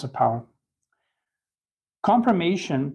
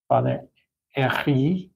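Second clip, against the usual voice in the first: Herry in a put-on French accent, silent H; exaggerated French accent; slower than usual. Shown as a demonstration. Lecturer trying out a French author's name, exaggerated French accent on Herry. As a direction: overdo the French throaty R in Herry. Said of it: "exaggerated French accent".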